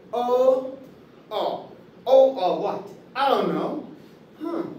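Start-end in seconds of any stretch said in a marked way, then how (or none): none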